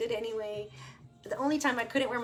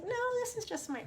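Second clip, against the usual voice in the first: quick, much higher chirpy reply; quoting herself; faster than usual. Retold or imitated. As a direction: quote your own breezy answer. And I was like.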